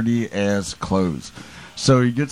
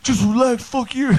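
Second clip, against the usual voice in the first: much higher, whiny mock voice; imitating Novak; 12 semitones higher than usual. Friend on a plane mocked, much higher whine. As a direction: squeak it out like a flustered drunk. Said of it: "And he's like".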